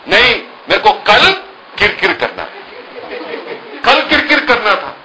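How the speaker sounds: put-on high whiny child voice; lightly voiced petulant little girl; whining child. Daughter whines to father, high petulant.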